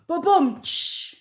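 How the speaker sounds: mouth-made ba-bum tsh rimshot. Pretend. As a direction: drum the punchline home with your mouth. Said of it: "Drum roll, please".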